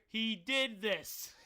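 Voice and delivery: loud, slow accusation; accusing. Retold or imitated. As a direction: call him out in front of everyone. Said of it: "and then I hear".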